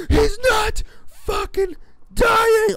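high, drawn-out frustrated scream. Retold or imitated. Gamer re-enacting rage at a zombie, screaming. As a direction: re-enact it at full shriek. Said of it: "how I know I was screaming when I was playing".

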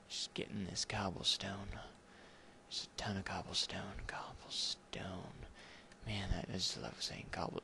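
near-whispered, savouring the word; soft and lingering, like sweet-talking a rock; creepy hushed relish. Pretend. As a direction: drop to a hushed, weirdly intimate murmur.

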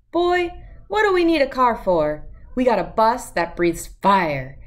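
high opening, playful grandma; cheerful grandma brushing off the question; lightly voiced upbeat Nana. Nana answers CJ.

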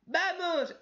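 sudden high cheering shout; hammed-up battle cry; mock cheer. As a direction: yell encouragement like a fan. Cheering the fighters on.

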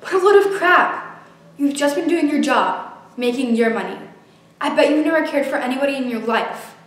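bitter, rising fury; angry outburst. Acted. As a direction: lash out in anger. Furious outburst at therapist.

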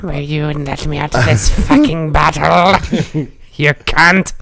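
high angry gremlin snarl; troll gremlin voice. Pretend. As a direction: snarl from inside the bottle. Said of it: "I'm the troll gremlin".

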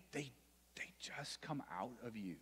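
whisper. Acted. Soft aside in sermon.

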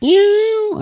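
high, squeaky mock cat mew. Preacher mimicking a cat to mock people, high mew. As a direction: squeak it out like a kitten. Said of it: "పిల్లిలా".

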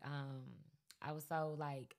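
usual speaking voice

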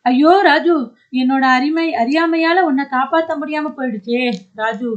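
a father wailing over his dead son; grieving wail. Acted. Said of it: "மார்பில் அடித்து கொண்டு அழுகின்றார்".

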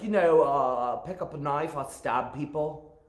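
imitated Scottish queen explaining casually; attempted Scottish accent. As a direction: put on an amateur Scottish accent. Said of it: "I can't do her accent, but she said".

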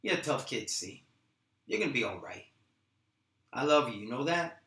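low, soft brotherly love; tender. Acted. Rocky tells C he loves him, tender. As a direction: speak gently to your little brother.

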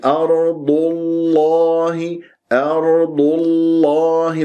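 slow chant, thick dark l in الله; heavy lam. Shown as a demonstration. Teacher shows Allah after damma, heavy-lam chant. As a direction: recite أرض الله with a heavy, held l. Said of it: "الله تفخم اللام".

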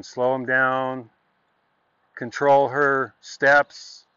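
very slow, drawn-out words; slow and deliberate. Shown as a demonstration. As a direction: stretch each word to show slowing. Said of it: "slow her feet down constantly".

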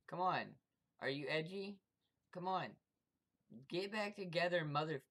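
ordinary talk, nothing marked

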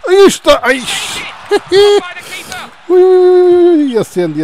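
very high, loud ui ui with a laugh; excited shriek. Real, not acted. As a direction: squeal at the near miss. Near-miss shriek. (grito agudo de emoção)

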